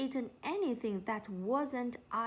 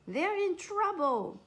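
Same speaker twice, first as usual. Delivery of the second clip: urgent, raised and higher; insistent; 9 semitones higher, 5 dB louder than usual. A narrator's reading. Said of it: "insisted Chip".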